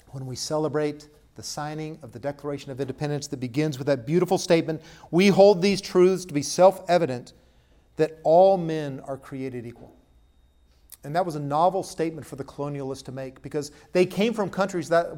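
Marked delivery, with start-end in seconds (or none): 5.1-10.0 louder, slowing proclamation; solemn recitation. Acted. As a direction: proclaim the famous words. Reciting the Declaration.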